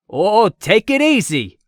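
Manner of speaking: high, loud, slow panic; panicked. A narrator's reading. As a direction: cry out in alarm. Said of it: "the man said in a panic".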